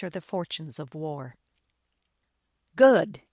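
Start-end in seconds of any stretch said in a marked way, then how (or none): 2.8-3.2 loud, higher burst of approval; officer's loud cry of approval; pleased exclamation. A narrator's reading. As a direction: exclaim it sharply, well satisfied. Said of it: "exclaimed Alexandrovitch, laughing".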